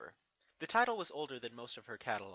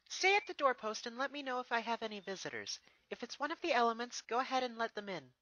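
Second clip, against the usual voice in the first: high, firm orders to the guards; commanding princess; 11 semitones higher, 4 dB louder than usual. Acted. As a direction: give a clear, polite command. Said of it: "she commanded to the guards".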